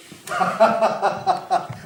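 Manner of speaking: long staccato villain cackle; triumphant mocking laugh. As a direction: cackle in repeated even bursts, gloating. Fire character mocks the other elements.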